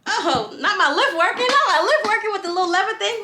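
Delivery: high-pitched mocking complaint; high mock whine. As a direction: squeak it out in disbelief. Mocking the lift mechanism.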